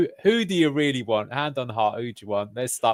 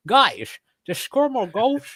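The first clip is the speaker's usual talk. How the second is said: high-pitched, slurred mock Dutch accent; sexy Dutch accent; 11 semitones higher, slower than usual. Fan imitating the new manager's simple team talk, sexy Dutch accent. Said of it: "with a sexy Dutch accent and go".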